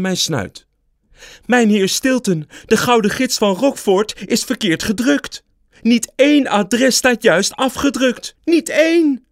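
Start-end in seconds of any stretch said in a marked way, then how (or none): 1.5-9.2 high, loud alarmed staff member; panicked editor. A narrator's reading. Editor rushes up to Stilton with disastrous news, high alarm. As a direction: blurt out the disaster, rising to a cry.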